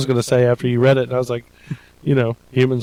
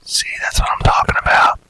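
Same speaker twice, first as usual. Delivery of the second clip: whispering. Pretend. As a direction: whisper it like a secret. Answers after choosing whisper-only.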